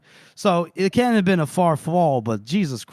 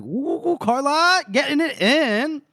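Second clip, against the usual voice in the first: high, drawn-out suggestive teasing; sing-song it up high in mock scandal; teasing whoop; 10 semitones higher, slower than usual. Teasing the mayor caught half-robed, playful whoop.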